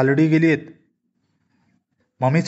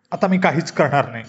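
ordinary delivery

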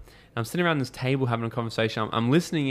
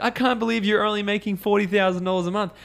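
higher, louder teasing put-on voice; mock disbelief; 9 semitones higher, 5 dB louder than usual. Mates ribbing each other over income, higher mocking voice. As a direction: rib a mate with fake outrage, pitched up. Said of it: "taking the piss out of each other by being like".

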